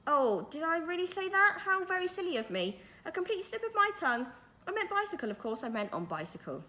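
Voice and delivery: put-on old magician, politely sorry; lightly voiced genial old wizard. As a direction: play the kindly magician explaining. Mister Majika covering his slip.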